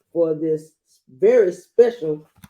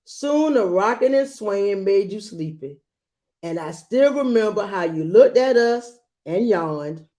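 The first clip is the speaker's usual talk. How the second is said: put-on gentle read-aloud to a child; storybook reading voice; story narration; 2 semitones higher than usual. A narrator's reading. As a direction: read it like a bedtime picture book. Reads picture book aloud.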